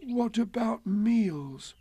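high, slow, forlorn question; woeful hungry bear; gloomy. A narrator's reading. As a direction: ask mournfully, drawing it out. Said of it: "said Pooh gloomily".

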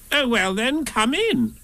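high-pitched conceding voice; Rabbit. A narrator's reading. Rabbit gives in and invites him.

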